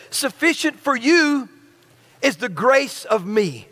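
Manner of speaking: "Sufficient for you" then a pause, "is the grace of me" slow and careful; slow reread. Shown as a demonstration. Pastor rereading the Greek slowly for a puzzled church. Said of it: "I'll read it again".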